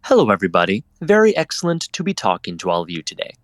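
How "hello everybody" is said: ordinary delivery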